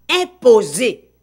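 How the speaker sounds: imposer stretched out slowly; stress on imposer. Shown as a demonstration. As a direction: lean hard on imposer, said slowly. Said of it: "non, mais imposer". (appuyer lentement sur le mot imposer)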